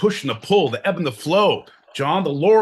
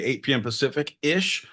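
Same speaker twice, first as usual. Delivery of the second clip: ordinary talk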